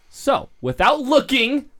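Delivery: loud, high and drawn out; sudden high-pitched yell; yelled announcement. Real, not acted. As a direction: yell it like an overeager quiz host. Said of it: "sorry, I yelled".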